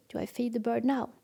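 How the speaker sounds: ordinary talk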